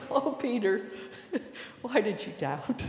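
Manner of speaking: soft teasing through a light chuckle; chuckling. Shown as a demonstration. Jesus grabbing his friend Peter by the hand, chuckling. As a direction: chuckle fondly as you gently rib a friend. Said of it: "saying this with a chuckle".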